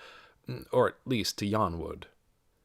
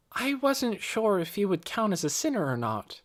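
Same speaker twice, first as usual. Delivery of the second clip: a hint of a girl's higher voice; lightly voiced young girl; 10 semitones higher than usual. A narrator's reading. Nicky answers Mrs. Beeson, lightly voiced as a girl. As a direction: lightly voice Nicky, eager and polite.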